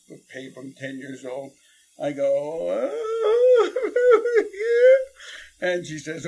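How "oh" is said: very high squeaky gasp then laugh; a frightened kid's yelp; hammed-up startled squeal; terrified squeak. Scared kid at the door.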